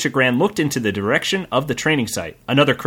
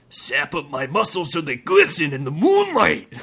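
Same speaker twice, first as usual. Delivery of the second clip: macho bandit. Acted.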